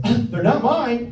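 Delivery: slow, high mock-innocent denial; high-pitched denial. Comedian acting out lying to his date about fingernails, high-pitched denial. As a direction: deny it high and drawn out.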